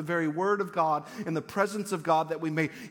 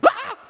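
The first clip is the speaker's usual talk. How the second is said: short piercing scream, very high and loud; startled, terrified shriek; shriek of terror; 12 dB louder than usual. Shown as a demonstration. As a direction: let out an alarmed yelp at full pitch. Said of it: "it's a shriek of terror".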